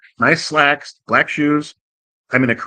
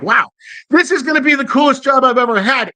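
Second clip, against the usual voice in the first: high-pitched, thrilled exclamation; hammed-up wide-eyed enthusiasm; acted excitement; 11 semitones higher than usual. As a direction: gush like it's the best thing ever. Acting out his excitement at job.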